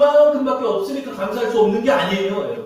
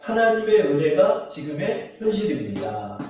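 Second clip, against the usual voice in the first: 하나님의 은혜가 지금의 현실입니다 said slowly, word by word; slow and clear so the church can echo it; slow lead line; 3 semitones lower than usual. Shown as a demonstration. Pastor leading the congregation to repeat, slow and clear. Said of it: "저를 따라할게요".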